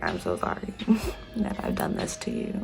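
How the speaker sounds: soft, breathy whispered sorry; apologizing to the kind neighbor in a whisper; whispered apology. Retold or imitated. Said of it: "And I was like".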